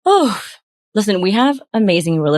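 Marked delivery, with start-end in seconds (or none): 0.1-0.4 exasperated oh. Real, not acted. Exasperated reaction to bad pitch.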